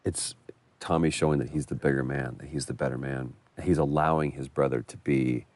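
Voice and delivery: ordinary talk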